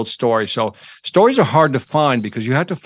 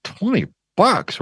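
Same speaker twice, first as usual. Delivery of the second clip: drawn-out, higher-pitched disbelief at a price; incredulous echo; 8 semitones higher, slower than usual. Retold or imitated. Customer balking at a young scout's popcorn price, incredulous. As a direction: balk at the price, stretching it slowly with swooping pitch.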